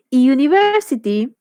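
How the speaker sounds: "university" begun with a clear "yoo" glide; teacher's demo of "university" starting like an I; initial Y-glide sound. Shown as a demonstration. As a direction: lean on the y-sound opening "university". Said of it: "empieza con una especie de I".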